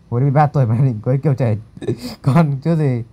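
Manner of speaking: real laugh breaking into the sentence; amused laughter. Real, not acted. Teasing someone about marrying as a child, amused laughter. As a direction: talk quickly, then break up laughing.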